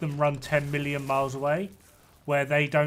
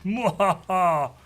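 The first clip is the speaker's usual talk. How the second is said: slow, high evil villain taunt; dark lord; 7 semitones higher, slower than usual. Acted. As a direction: cackle like a cartoon dark lord.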